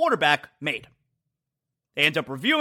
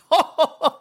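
short burst of laughter, higher; real laugh; 8 semitones higher, 3 dB louder than usual. Real, not acted. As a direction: crack up again. Laughs again.

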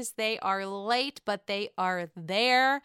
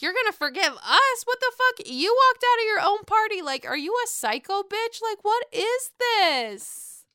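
high indignant outburst; mock outrage; 9 semitones higher, 5 dB louder than usual. Robin snapping at Karen, high, outraged. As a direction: fire back in disbelief. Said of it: "Robin's like".

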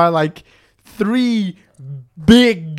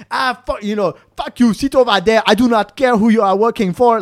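high, loud put-on African accent; hammed-up bad cop in an African accent; mock aggressive; 4 semitones higher, 5 dB louder than usual. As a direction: snub him with acted aggression. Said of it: "the really aggressive one".

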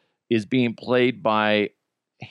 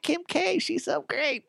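high, softer mock fan voice; gushing fan; 6 dB quieter than usual. Imagined fan pushing Kim Kardashian for the bill, mock gushing. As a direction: put on a high-pitched fawning admirer. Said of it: "somebody would suggest that. Be like".

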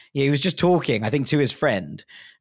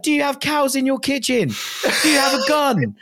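eager fans; 8 semitones higher than usual. Retold or imitated. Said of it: "they're literally like".

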